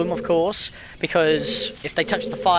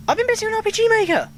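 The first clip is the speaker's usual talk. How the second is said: high squeaky voice; 3 dB louder, faster than usual. Pretend. Restarts line after interruption, high voice.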